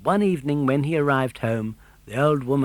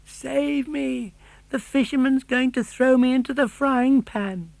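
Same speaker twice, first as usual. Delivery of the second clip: high, far-off puppet voice calling; a lightly voiced desperate puppet; calling for help; 12 semitones higher, 4 dB louder than usual. A narrator's reading. Pinocchio calling the dog to save him, pleading cry. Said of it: "In a weak voice he called out".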